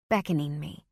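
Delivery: plain reading voice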